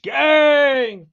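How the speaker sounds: high, loud holler with very long held vowels; drawn-out hype bellow; slogan shout. Acted. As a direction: belt out the rallying cry, stretching each word.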